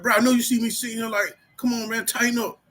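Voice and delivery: high, fast confrontational callout; imitating his old short temper; old aggressive self. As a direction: press him hard, fast and high. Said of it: "That's how I used to be".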